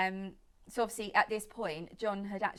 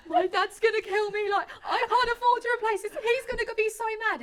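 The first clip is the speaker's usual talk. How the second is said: much higher and louder, panicked through laughter; half-laughing, half-panicking about dad's reaction; 13 semitones higher, 10 dB louder than usual. Retold or imitated. Retold panic about the damaged car.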